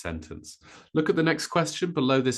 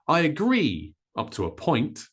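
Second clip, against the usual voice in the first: "agree" high on "gree", "up to a" short before "point"; stress on agree and point; 5 semitones higher than usual. Shown as a demonstration. Teacher modelling stress for learners, "agree" and "point" strong. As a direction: stretch the end of "agree", skim "up to a". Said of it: "So very fast".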